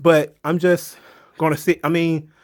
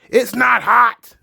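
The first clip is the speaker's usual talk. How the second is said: higher, louder, slow mock yell; ridiculing bad acting with a higher, louder shout; 8 semitones higher, 5 dB louder, slower than usual. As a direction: belt it out like a hammy movie line.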